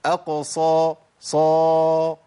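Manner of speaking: أقصى and صا with pouted lips and a heavy ص, the last one loud; rounded lips emphatic. Shown as a demonstration. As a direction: push the ص out through rounded lips. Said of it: "وهاي التبويزة تجيب معاها صاد".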